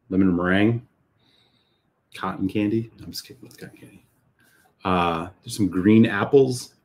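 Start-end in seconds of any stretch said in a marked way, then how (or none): 3.0-4.1 quick whispered joke; whispered aside. Real, not acted. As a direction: toss it off in a fast whisper. Joking aside after tasting notes.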